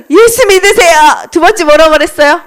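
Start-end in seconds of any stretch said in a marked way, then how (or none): 0.1-1.3 short, high proclamation; high-pitched preaching call; evangelist's cry. Acted. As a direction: call it out high, prompting the children. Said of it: "뭐라고 말했다고?".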